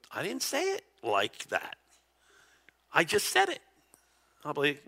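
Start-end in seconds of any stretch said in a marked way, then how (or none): 0.1-3.6 lightly voiced defensive protest; mock defensive wife. Wife answering her husband's complaint about her tone, mock defensive. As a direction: play at denying the accusation. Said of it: "And she'll go".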